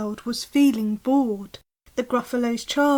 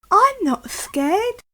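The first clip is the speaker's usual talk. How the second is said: higher, excited little monster voice; eager Gruffalo child; 9 semitones higher, 5 dB louder, slower than usual. Acted. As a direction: pester dad like a curious kid. Child declares bravery.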